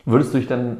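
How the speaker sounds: usual speaking voice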